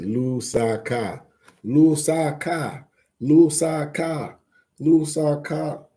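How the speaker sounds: "Lusaka" repeated slowly, loo-SAH-kah; practising Lusaka. Shown as a demonstration. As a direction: drill "Lusaka" slowly, each syllable clear. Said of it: "That's it".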